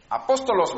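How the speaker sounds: Apóstolos with stress on the first o, a-PÓS-to-los; Apóstolos, acento en la primera o; Greek pronunciation. Shown as a demonstration. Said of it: "Con el acento en la primera O".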